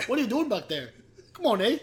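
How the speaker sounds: exaggerated Canadian, ending on "eh"; mock Canadian accent. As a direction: put on a broad Canadian lilt. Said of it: "Mine's Canadian".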